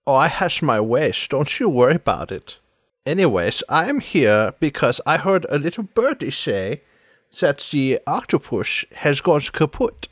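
put-on theatrical accented villainess; sly accented voice, s sliding toward sh; Sans Serif, coy. Dodging his question about her return, coy accented voice.

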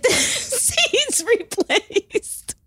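slow, laughing through the words. Real, not acted. Broke student laughing about burnt car seats, laughing. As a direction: giggle while admitting being broke.

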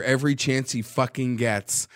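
usual speaking voice

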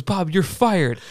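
retold heated dismissal; angry firing. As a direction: act out an irate coworker firing someone. Retold fight, repeated firing.

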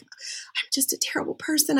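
high, breathless whisper of shame; imitating her old despairing inner voice in a whisper; self-pitying whisper. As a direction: whisper it like a guilty confession. Quoting own despairing denial-phase thoughts.

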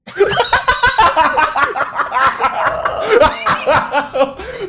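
shrieking laughter that goes on and on; deafening laugh. Real, not acted. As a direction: burst into piercing, uncontrolled laughter. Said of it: "David fucking deafened for a second".

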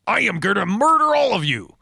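hammed-up fury; furious mock threat; mock rage.

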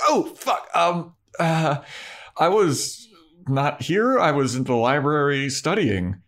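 high, halting mock panic; caught out, flustered. Imitating Buffy caught by Giles and Willow, flustered.

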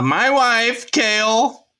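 twitchy squeaking rat voice; high, slow silly voice. Pretend. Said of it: "In your silliest voice".

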